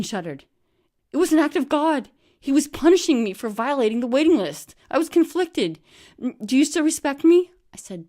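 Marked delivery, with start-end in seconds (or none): 1.1-7.5 high, loud pleading wail; a distraught friend begging, pitched up and loud; high loud wail of a guilty friend; Bean's anguished voice. Retold or imitated. Bean's tearful confession.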